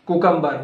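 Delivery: "Cucumber" as KOO-kum-ber; wrong CUCUMBER. Shown as a demonstration. Teacher quizzing learners on "Cucumber", said as KOO-kum-ber. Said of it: "No, incorrect".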